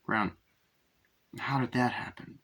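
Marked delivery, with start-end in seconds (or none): 1.4-2.4 whisper. Retold or imitated. Acting out film scene.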